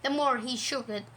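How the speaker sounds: ordinary delivery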